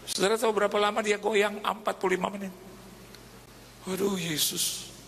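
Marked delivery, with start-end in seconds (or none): 3.9-4.9 slow, drawn-out groan of dread; mock dread. Preacher reliving the turbulence, slow dread. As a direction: let the fear sink in.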